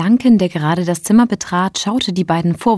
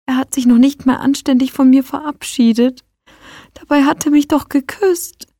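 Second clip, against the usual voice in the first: heartbroken; 8 semitones higher, 4 dB louder than usual. Acted. Heartbroken friend complains tearfully.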